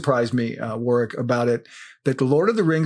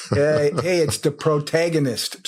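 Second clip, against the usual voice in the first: hammed-up American Midwestern accent. Shown as a demonstration. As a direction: play up a homely Midwestern twang. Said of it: "me with my Midwestern accent".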